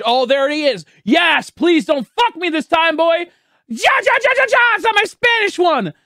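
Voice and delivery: high, loud, rising jubilation; excited outburst. Real, not acted. The shiny appears again.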